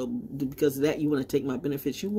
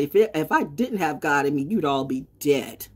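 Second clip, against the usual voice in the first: ordinary delivery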